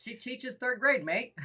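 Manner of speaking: badly faked Australian accent; attempted Australian accent. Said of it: "I can't do an Australian accent".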